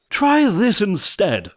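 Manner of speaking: high, loud, slow yell; yelling back. A narrator's reading. Prince yelling at sister number two. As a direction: fling it back at her. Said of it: "the prince yelled back".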